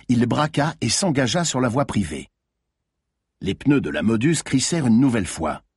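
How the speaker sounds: ordinary delivery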